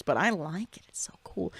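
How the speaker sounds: whispered, admiring aside; quietly awed, almost to yourself; hushed delight. Real, not acted. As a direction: drop to a soft whisper.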